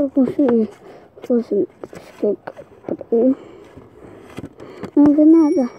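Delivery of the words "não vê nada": loud exclaim. Real, not acted. Loud exclaim, then whispered repeat.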